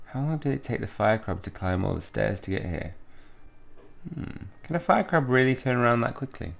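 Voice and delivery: ordinary talk, nothing marked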